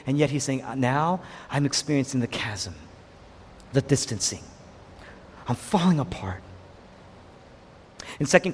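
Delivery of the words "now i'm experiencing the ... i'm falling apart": low, hushed and halting; subdued suffering. Acted. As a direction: let it sink, softly, with pauses. Voices Jesus forsaken on cross.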